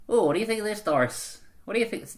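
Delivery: a touch of a gossiping granny; old lady voice. Retold or imitated. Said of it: "It's just my old person voice".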